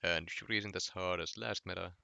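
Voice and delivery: ordinary talk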